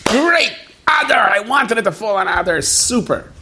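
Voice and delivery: high, delighted crowing cheer; gleeful Haman. Acted. The preacher voicing Haman, delighted the lot fell on Adar, high gleeful crowing. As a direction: crow it like a villain sure he has won.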